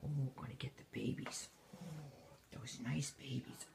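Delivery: breathy hushed teasing; whispering. Real, not acted. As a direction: hush it, build suspense.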